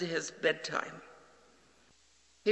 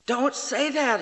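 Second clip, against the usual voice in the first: put-on much higher, louder child cry of protest; the boy insisting, voice pitched up; 8 semitones higher, 8 dB louder than usual. Son protesting his father's love, higher and louder. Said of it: "he said again".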